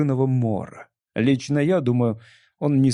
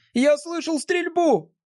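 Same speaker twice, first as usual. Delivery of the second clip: put-on high, excited boyish shout; Jimmy shouting. A narrator's reading. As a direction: call out breathless and high. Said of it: "крикнул он".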